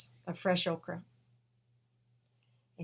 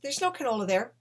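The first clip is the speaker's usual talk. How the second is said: higher and louder protest; arguing back; 6 semitones higher, 6 dB louder than usual. Retold or imitated. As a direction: answer back, raised and indignant. She argues back at the vision.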